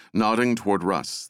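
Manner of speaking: usual speaking voice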